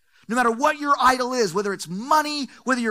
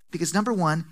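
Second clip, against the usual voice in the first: ordinary talk, nothing marked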